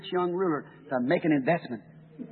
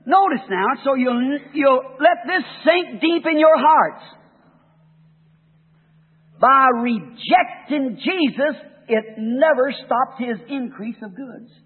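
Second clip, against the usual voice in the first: louder, higher, slow and emphatic; preaching at full force; 7 semitones higher, 7 dB louder, slower than usual. Acted. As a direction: pulpit exhortation to his congregation. Preaching builds to the close.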